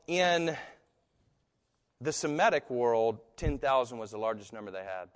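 ordinary talk

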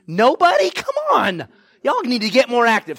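high, loud prodding of the audience; incredulous call-out. Real, not acted. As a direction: call out in disbelief for a response. Prodding an unresponsive congregation.

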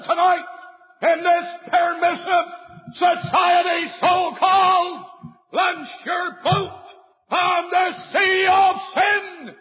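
pulpit-pounding revival preaching; preaching at full force. Acted. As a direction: thunder it at the young sinner. Preacher warns young people.

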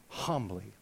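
low, soft echo of the word; humble hush. Shown as a demonstration. Preacher shows the congregation how to read, in a low humble hush. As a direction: drop right down, humbly.

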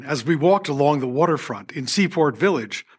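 ordinary talk, nothing marked

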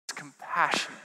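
normal talking voice

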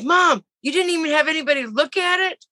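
high-pitched exasperated scolding; retold upset protest, pitched well up; upset daughter. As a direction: imitate a daughter scolding mom in dismay. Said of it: "she was so upset".

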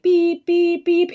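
high beeping like a reversing truck; imitated reverse beeps. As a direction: beep it out like a backing-up truck. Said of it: "instead of a truck going, like".

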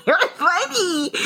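high, slow words through real laughter; laughing. Real, not acted. Laughing at her friend's reaction to the texts.

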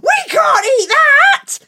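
high, loud shocked shout. Acted. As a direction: cry out in disgust. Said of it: "said Mum".

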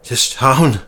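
mildly acted sob; a touch of tearful despair; sobbing. A narrator's reading. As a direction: say it as if sobbing, lightly. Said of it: "he sobbed".

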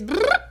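high lip-trill shudder; playful high-pitched motor-lip release; shake-off brrr. Real, not acted. Shaking off struggles and worries.

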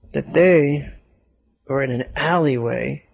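ordinary delivery, nothing marked

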